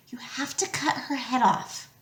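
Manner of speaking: breathy whisper. A narrator's reading. Annabeth whispering the plan to Percy. Urgent hiding instruction.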